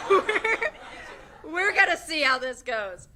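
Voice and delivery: laughing while talking. Real, not acted. Student starting an unprepared speech, laughing through it. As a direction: push the words out through laughter.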